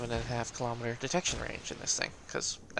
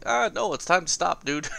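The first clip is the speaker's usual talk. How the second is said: mock exasperated plea; 3 semitones higher, 7 dB louder than usual. Player imitating himself begging an aggressive teammate to stop, mock exasperated. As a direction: plead loudly, playfully exasperated.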